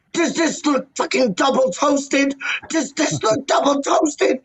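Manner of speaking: high, outraged mock rant, slowing near the end; furious rant. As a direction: rant about the bagels, pitched high. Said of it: "he did his nut in".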